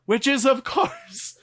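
slow, loud sponsor announcement; announcer voice. Acted. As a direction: boom it out like a radio ad. Podcast sponsor read, announcer.

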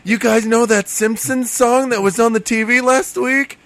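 high, eager schoolboy voice; high-pitched Phil. Acted. Kid asking friends about a Simpsons song, high-pitched. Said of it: "high pitch Phil".

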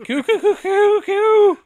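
very high cooing calls; mock bird cooing. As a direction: coo playfully like a bird. Bird noise punning on coup.

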